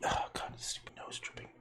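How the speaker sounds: a breathy whisper, no voice at all; whispered aside. Real, not acted. Painter whispering to himself about his dripping nose. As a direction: complain under your breath.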